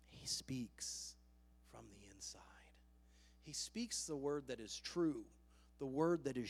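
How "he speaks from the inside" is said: soft, low whisper; whispered, with reverent pauses; quieted down. Shown as a demonstration. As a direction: hush it to an inner voice. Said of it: "When we quiet ourselves down".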